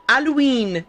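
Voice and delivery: Halloween without the H, al-oo-een. Shown as a demonstration. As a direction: drop the first sound of Halloween. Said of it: "No H".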